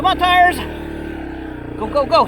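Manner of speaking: yelling at the tires; shouting. Real, not acted. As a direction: shout them on, high and loud. Urging stuck truck.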